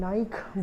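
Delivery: Nike as one syllable, "nike" like "like"; common mispronunciation. Shown as a demonstration. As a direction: say Nike with a silent e, "nyke". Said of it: "ये भी एक गलत pronunciation है".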